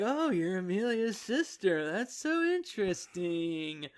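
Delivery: slow, soft villain voice; imitated sly villain. Blue Man learns the girl is Amelia's sister, sly and slow. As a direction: draw it out quietly, as if secretly interested.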